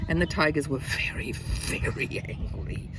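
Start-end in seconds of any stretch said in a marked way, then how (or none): 1.3-2.9 slow, drawn-out growly emphasis; growled anger. A narrator's reading. Narrator describing the furious tigers, growled slowly. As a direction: stretch the words into a mock growl.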